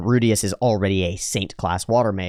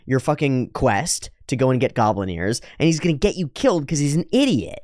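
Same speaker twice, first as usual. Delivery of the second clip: ordinary delivery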